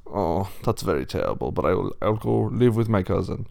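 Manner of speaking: put-on resigned German accent; German-accented pig. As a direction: accept it sadly in a German accent. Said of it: "going a little Arnold Schwarzenegger".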